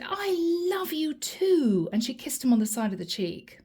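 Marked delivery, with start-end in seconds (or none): 0.0-1.9 high, slow call of "I love you too" over the band; shouted back. Retold or imitated. Woman answering over a loud band, shouting. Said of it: "She shouted back and said".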